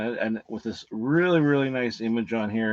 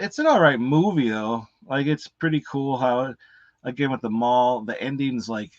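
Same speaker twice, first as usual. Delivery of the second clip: ordinary delivery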